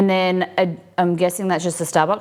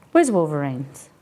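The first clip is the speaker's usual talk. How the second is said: ordinary delivery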